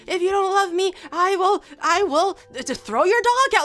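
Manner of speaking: high, loud mock hysteria; unhinged girlfriend act. Imitating a landmine girl demanding love, mock-unhinged. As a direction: threaten like a hammed-up crazy lover, pitched right up. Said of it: "They're unhinged".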